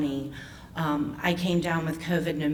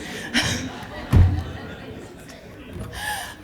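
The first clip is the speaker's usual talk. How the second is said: embarrassed laugh. Real, not acted. Speaker to the audience after an awkward remark, sheepish laugh.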